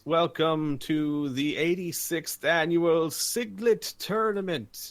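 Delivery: high, slow showman's announcement; arena announcer. Acted. Announcer opening the tournament for a cheering crowd, grand and drawn-out. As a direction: draw out the tournament title for the crowd. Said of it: "in the mega speakers, there is".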